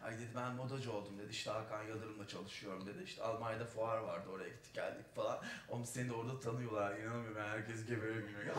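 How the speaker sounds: lightly voiced effeminate chatter; effeminate friend. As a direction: gush like a camp friend sharing news. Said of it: "biraz efemine bir arkadaştı".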